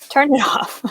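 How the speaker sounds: ordinary talk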